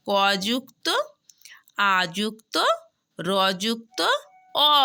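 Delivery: letter-by-letter spelling. Shown as a demonstration. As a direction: spell it out like a teacher drilling a class.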